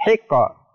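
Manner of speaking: "hiqqa" with the q bounced, like hi-ko; qalqalah bounce. Shown as a demonstration. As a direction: release the q of "hiqqa" into a short echo. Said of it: "ada pantulannya ya".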